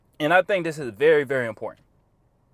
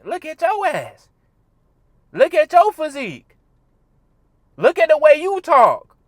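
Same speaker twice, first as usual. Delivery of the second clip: heated scolding; 9 semitones higher, 7 dB louder than usual. Real, not acted. Scolding lazy commenters.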